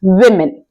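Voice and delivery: विमेन said as "wi-men", with a short i; विमेन, never "woo-men"; correct pronunciation. Shown as a demonstration. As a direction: stress the first syllable of विमेन, "wim". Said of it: "इसका सही pronunciation होता है".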